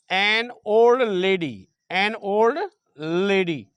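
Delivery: word by word, long pauses between; slow dictation. Shown as a demonstration. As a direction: dictate slowly and firmly as if writing it out. Dictating example sentence while writing. (धीरे-धीरे, रुक-रुक कर लिखवाते हुए)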